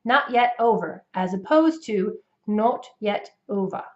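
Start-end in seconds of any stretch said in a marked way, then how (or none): 2.5-3.8 British imitation, crisp T's, closed rounded O; British accent. Shown as a demonstration. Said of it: "for the British accent".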